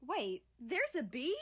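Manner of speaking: high, loud alarmed question; sit up suddenly; startled. Acted. America startled hearing about a bee.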